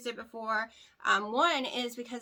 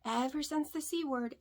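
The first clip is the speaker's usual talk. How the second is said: ordinary talk, nothing marked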